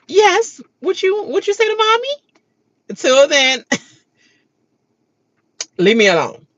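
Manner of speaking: scolding mommy. Acted. Mom teasing her kids, high sing-song. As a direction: ask it like a playful mom.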